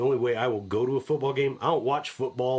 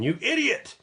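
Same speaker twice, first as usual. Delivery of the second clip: much higher mock yelp; panicked godfather; 13 semitones higher than usual. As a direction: imitate a panicking outburst. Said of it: "the Godfather's panicking, going".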